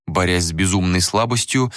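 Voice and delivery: plain reading voice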